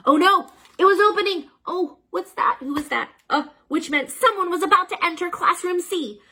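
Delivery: high, loud panicky narration; alarmed. A narrator's reading. Narrator panics as the door opens. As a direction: sound the alarm.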